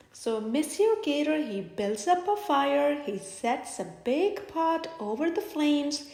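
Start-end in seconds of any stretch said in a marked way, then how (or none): none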